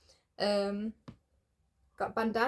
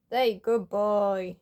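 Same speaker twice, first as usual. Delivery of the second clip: slow drawn-out pet praise; slow cooing praise; 6 dB louder, much slower than usual. Real, not acted. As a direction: coo the praise slowly. Praising cat.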